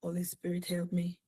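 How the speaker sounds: softly breathing a plea to the Spirit; hushed, private prayer under the breath; whispered prayer. Real, not acted. Quiet prayer before preaching.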